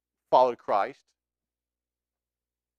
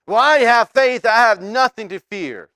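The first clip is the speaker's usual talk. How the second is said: loud, much higher, breezy overconfident claim; mock boastful believer; 9 semitones higher, 13 dB louder than usual. Preacher mimics a believer boasting he has no fear, loud and smug. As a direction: sound cocky and sure of yourself.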